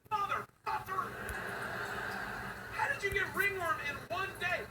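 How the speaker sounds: high alarmed outburst; shocked. Retold or imitated. Dad hearing daughter has ringworm, shocked. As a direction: blurt out in disbelief.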